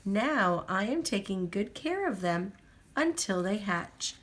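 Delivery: usual speaking voice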